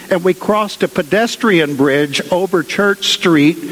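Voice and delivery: ordinary talk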